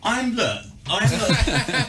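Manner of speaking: high mock Mackem accent; Sunderland accent. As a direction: put on a Mackem voice. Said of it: "And how does that go?".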